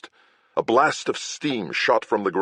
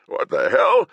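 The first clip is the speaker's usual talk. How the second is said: startled; 5 semitones higher, 5 dB louder than usual. Acted. Startled rider.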